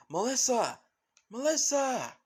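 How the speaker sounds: high, loud shout for a missing daughter; calling out. Acted. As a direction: call out loudly across a distance. Father calling out for his daughter.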